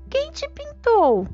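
high, slow innocent question; curious child voice. Acted. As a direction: ask it wide-eyed like a child. Girls ask each other.

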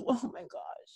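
breathless whisper, overcome; whispered oh my gosh. Real, not acted. Host overwhelmed by the love story, whispering. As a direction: whisper it, too moved to speak.

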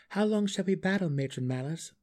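a lightly voiced defiant dark elf; defiant Drizzt. A narrator's reading.

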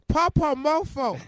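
put-on toddler voice. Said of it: "he said".

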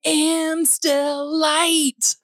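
ordinary delivery

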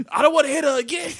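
high, fast, loud mock wail; whiny pleading. Boy begs out of fighting anymore, high wailing plea. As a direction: cry it out like a scared punk. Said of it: "'cause I didn't wanna fight anymore".

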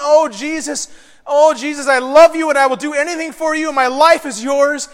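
hammed-up worshipper's pledge; mock fervent praise. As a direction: pour out showy love to Jesus. Imitates fervent praise before hypocrisy.

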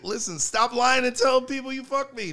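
high, loud call-out; loud plea. Real, not acted. Addressing women who lie about him, loud. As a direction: shout it at the liars. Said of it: "addresses ladies".

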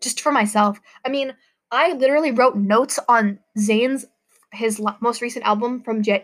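ordinary delivery

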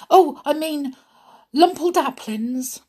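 high, loud, muddled self-correction; excited spluttering. Acted. Doc tries to fix his tangled words, excited spluttering. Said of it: "Doc spluttered excitedly".

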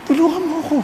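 small, weeping cry for help; tearful prayer. Acted. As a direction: beg quietly through tears. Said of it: "habang umiiyak, bigla na naalala".

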